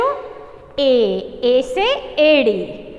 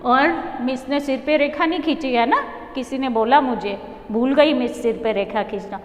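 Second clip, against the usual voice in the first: usual speaking voice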